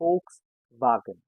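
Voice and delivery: Volkswagen slowly, as "FOLKS-vaah-gen"; German pronunciation. Shown as a demonstration. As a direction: stretch Volkswagen with a long ah. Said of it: "A sounds as double A".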